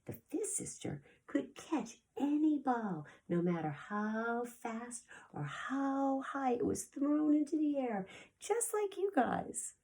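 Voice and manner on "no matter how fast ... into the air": ordinary delivery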